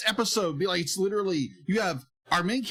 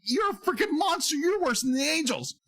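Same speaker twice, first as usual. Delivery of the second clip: high, heated exclamation; mock outrage; 8 semitones higher than usual. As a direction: blurt it out appalled. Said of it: "it's just like".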